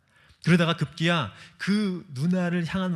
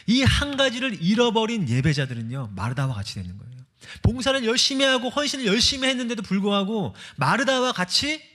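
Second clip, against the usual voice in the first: emphatic preaching; 7 semitones higher, 4 dB louder than usual. Real, not acted. Preaching climax.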